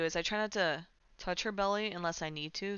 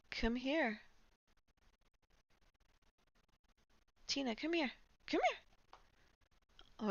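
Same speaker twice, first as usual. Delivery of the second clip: pet talk; 7 semitones higher than usual. Real, not acted. Calling the cat.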